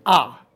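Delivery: "ah" with the mouth wide open; open-mouthed vowel. Shown as a demonstration. Lecturer showing how we make the sounds, open-mouthed "ah". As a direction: jaw dropped for an isolated "ah". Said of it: "mouth wide open".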